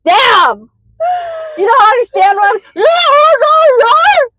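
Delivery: high, slow, crying shout; tongueless crying shout. Pretend. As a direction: wail it as if tongueless.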